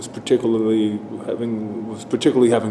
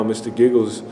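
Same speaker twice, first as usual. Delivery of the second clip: usual speaking voice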